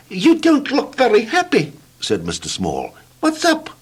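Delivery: a tiny high squeak of a voice. Acted. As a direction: voice little Mister Small, very high. Mister Small speaks.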